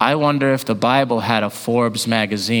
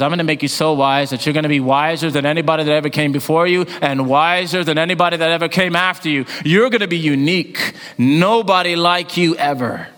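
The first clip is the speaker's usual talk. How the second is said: preaching at full force; 6 semitones higher than usual. Acted. Preacher voicing God's promise to Solomon, fast full preaching voice. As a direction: announce God's promise with rising fervor.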